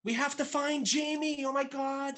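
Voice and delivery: high falsetto, drawn out and mocking; mock panicked movie character. Co-host mimicking characters who must find Jamie, mock falsetto. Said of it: "they're like".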